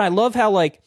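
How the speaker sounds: usual speaking voice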